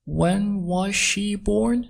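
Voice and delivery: slow, word by word, high pitch; slow, careful model reading; slowed-down teaching repeat. Shown as a demonstration. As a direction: stretch out each word for learners. Teacher repeats line slowly for learners.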